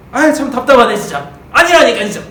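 frustrated denial. Acted. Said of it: "이렇게 하는 거죠".

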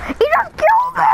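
very high, shocked squeal; outraged squeal. Real, not acted. Player just killed by a hacker, shocked squeal. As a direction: shriek like you can't believe it.

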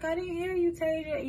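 a hint of a scared big sister calling her name; worried sister. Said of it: "She was like".

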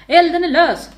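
high, very loud cry of fire; loud warning shout; shouted alarm. A narrator's reading. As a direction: call it out urgently across a crowd. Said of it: "kungen ropade".

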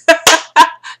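high teasing laugh; laughing at her own tease; playful laugh. Real, not acted. Laughs after teasing Apostle Buckner.

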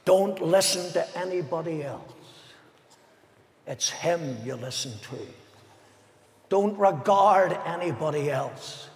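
usual speaking voice

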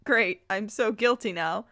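ordinary talk, nothing marked

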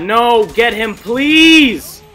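loud wail, stretching out please; drawn-out pleading yell; desperate plea. Real, not acted. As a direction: beg them loudly with a long final word. Pleading as enemy escapes.

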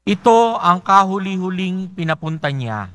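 ordinary talk, nothing marked

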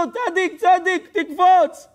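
high, slow, loud foreign-sounding plea; pleading American. Acted. American at the pool begging a man to jump in, high and pleading. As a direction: drag out the begging like a desperate tourist.

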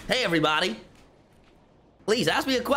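put-on over-the-top American accent; fake American accent. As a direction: play a cartoonish American host. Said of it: "if I do an American accent".

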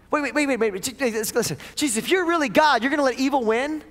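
frantic disciple begging his teacher; pleading Peter. Acted. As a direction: beg him not to give up. Peter pleading with arrested Jesus.

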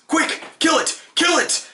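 very high, squealed panic cries; screamed. A narrator's reading. Knights screaming at a cat in the courtyard, shrieked. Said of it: "they each screamed".

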